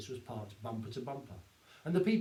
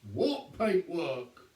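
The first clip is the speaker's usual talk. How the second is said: mock innocence; 2 semitones higher, 4 dB louder, much slower than usual. A narrator's reading. Sergeant playing innocent.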